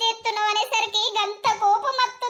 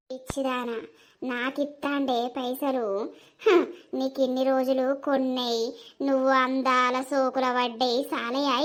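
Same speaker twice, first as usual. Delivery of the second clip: much lower, scornful taunting voice; mocking low voice; 10 semitones lower, 3 dB quieter than usual. Voice taunting the crying wife, low and scornful. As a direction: sneer at her, deep and contemptuous.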